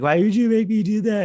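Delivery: high, loud mock-anguished wail; mock distraught. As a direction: cry out in hammed-up horror, high and loud. Acts out horrified man's protest.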